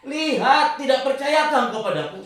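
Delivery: loud, high rebuke; rebuking voice of God. Retold or imitated. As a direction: scold him like a stern master. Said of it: "Suaranya menghardik". (suara Tuhan yang menghardik dengan keras)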